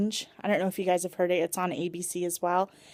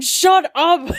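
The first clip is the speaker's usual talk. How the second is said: higher, much louder stunned outburst; shocked "shut up"; 9 semitones higher, 9 dB louder than usual. Retold or imitated. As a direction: blurt it out in total disbelief. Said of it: "And I'm like".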